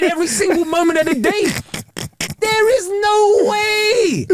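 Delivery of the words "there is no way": emphatic outcry. Real, not acted. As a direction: stretch out the disbelief. Emphatic climax of point.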